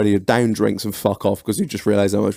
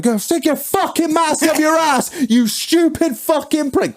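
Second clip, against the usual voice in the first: high mock yell at the doorman; hammed-up furious telling-off; mock angry rant. Retelling swearing at the club tout, high mock yell.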